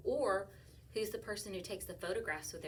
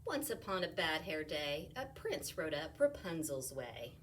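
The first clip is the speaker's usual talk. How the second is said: lively read-aloud, louder as it builds; reading to children; 4 semitones higher, 7 dB louder, slower than usual. Acted. Teacher reading a rhyming picture book aloud. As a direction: bounce through the rhymes for kids.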